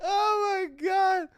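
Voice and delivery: high, slow wail after laughing hard; real laughing disbelief; helpless amusement. Real, not acted. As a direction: gasp it out, spent from laughter.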